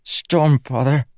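high, drawn-out plea of dismay; distressed cry. Acted. Kaladin trying to staunch Nalma's blood, distressed cry. Slave watching his friend bleed.

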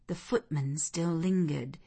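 ordinary delivery

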